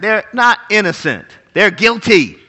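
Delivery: ordinary delivery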